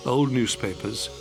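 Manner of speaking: usual speaking voice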